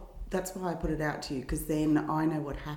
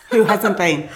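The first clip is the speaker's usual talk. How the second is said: laughing; 3 semitones higher, 12 dB louder, slower than usual. Real, not acted. Joke about own podcast.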